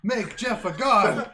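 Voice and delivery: slow, loud, laughing. Pretend. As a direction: belt it out through laughter. Repeated, louder, slower, laughing.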